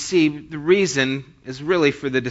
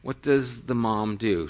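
usual speaking voice

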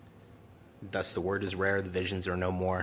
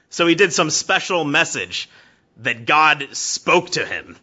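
loud, higher voice, sneering; mock the preacher loudly, with scorn; mocking brother; 7 semitones higher, 11 dB louder than usual. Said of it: "he goes".

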